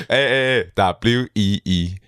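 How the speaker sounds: slow, letter by letter; spelling it out. Shown as a demonstration. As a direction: spell each letter out slowly. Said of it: "快速的带过这个拼音".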